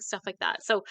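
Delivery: ordinary talk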